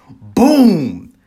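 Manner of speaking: very loud, high blast; booming rocket imitation. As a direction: burst out like a signal rocket.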